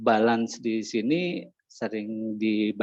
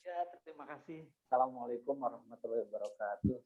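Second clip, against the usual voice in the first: very faint, far from the mic; much softer, words almost lost; barely audible; 13 dB quieter, faster than usual. Real, not acted. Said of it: "volume suaranya agak terlalu kecil".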